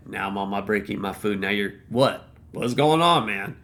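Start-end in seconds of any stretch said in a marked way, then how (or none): none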